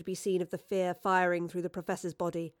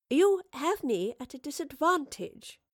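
higher-pitched, composed retort; countering; 7 semitones higher than usual. Acted. Professor hides unsettled nerves from creature, countering. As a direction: counter politely, nerves held in. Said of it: "the professor countered".

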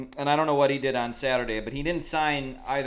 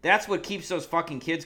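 worked up; 7 semitones higher, 6 dB louder than usual. Real, not acted. Ranting about the candy kids.

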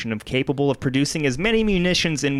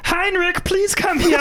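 very high, hammed-up German accent; 3 dB louder than usual. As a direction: squeal for a henchman in cartoon German accent. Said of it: "that's a German accent".

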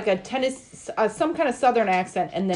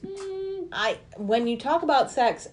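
ordinary delivery

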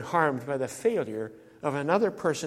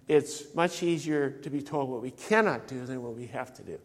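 usual speaking voice

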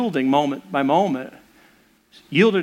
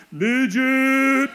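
a high mock shout from a passing snowboarder; quick high taunting call; mock yell; 6 semitones higher than usual. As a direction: call it out while zooming past. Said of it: "and yells".

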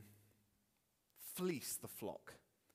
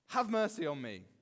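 raised-voice plea, moderately loud; a restrained version of a shout for help; calling out; 2 semitones higher, 7 dB louder than usual. Acted. Worshipper wanting to meet God, calling out. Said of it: "call out at the top of my lungs".

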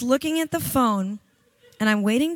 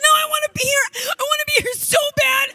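imitating crying; 12 semitones higher, faster than usual. PA begging to stay, mock crying shout. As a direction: wail that she loves it here. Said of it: "she's crying".

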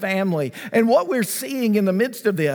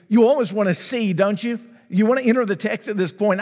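ordinary talk

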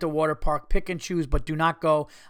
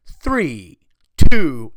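called-out countdown; 16 dB louder than usual. Retold or imitated. Slide operator to waiting rider, calling the countdown loudly. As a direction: imitate a loud launch countdown.

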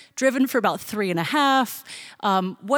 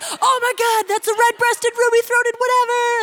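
much higher, gushing crowd voice; excited guessing; 13 semitones higher, 4 dB louder than usual. Retold or imitated. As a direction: squeal out the bird names like a thrilled fan.

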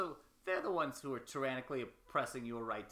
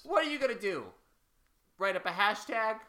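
raised, louder, pointed questioning; goading challenge; 6 semitones higher, 6 dB louder than usual. Acted. Shaming a friend into resisting a tyrant, loud goading. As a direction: press hard, stretching out the taunt.

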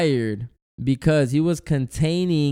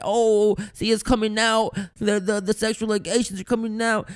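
higher, gleeful I-told-you-so; gloating conspiracy theorist; 6 semitones higher than usual. Retold or imitated. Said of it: "When Cuomo got arrested".

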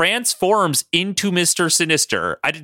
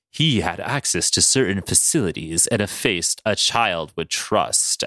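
low, measured villain voice; Mister Sinister; 9 semitones lower than usual. Acted. Sinister explains posing as Arcade, low and composed. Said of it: "And Sinister is like".

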